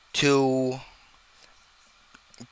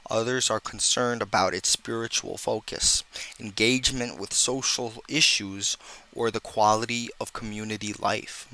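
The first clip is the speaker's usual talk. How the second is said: softer, lower, measured essay read; reading aloud; 5 semitones lower, 5 dB quieter than usual. Real, not acted. Reading a summary of grievances about the church, measured read-aloud. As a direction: read out a formal conclusion evenly. Reads out a written conclusion.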